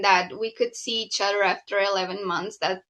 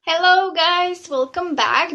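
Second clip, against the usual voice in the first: cheerful greeting; 7 semitones higher, 3 dB louder than usual. Acted.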